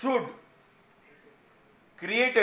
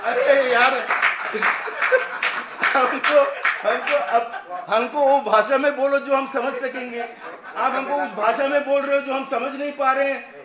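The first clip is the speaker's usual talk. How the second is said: laughing; 5 semitones higher, 7 dB louder, much faster than usual. Retold or imitated. Laughing while voicing citizens' complaint.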